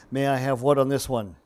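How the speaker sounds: usual speaking voice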